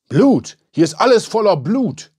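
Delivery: high, loud cry of horror; panicked yell; alarmed scream. A narrator's reading. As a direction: shout it in shock, voice leaping up. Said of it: "schrie er".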